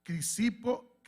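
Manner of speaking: ordinary talk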